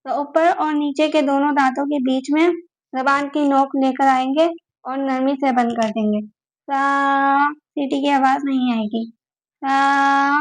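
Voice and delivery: normal talking voice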